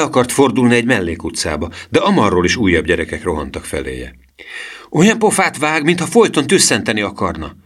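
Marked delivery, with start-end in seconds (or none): 4.9-7.6 high, called-out jeer; mocking shout; boy's shout. A narrator's reading. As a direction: call it out in a raised, higher voice. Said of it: "kiáltotta Gusztáv".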